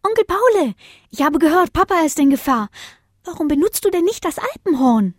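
an eager little pup with an idea; small child. Acted. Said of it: "rief Bodos Jüngster".

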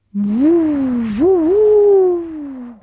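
hohes, lautes Windheulen; unheimliches Jaulen. A narrator's reading. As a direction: heul gespenstisch wie ein Sturm. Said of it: "Unheimliches Jaulen". (gespenstisches lautes Jaulen)

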